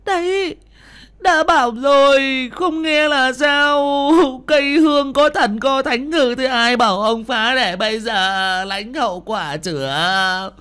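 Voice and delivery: very high, loud, slow lamenting scold; wife scolding her stricken husband; bitter scolding. A narrator's reading. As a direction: scold and wail at once. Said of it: "nói như mắng".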